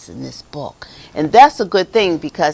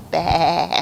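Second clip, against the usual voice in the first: lamb baa. Acted. Storyteller imitates the restored lamb.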